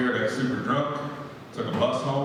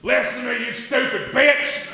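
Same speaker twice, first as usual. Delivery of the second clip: much higher, strained screech, slow on the insult; 13 semitones higher than usual. Retold or imitated. West Virginia man screaming at his phone's assistant. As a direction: scream it at the phone like it's stupid. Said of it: "this guy just went".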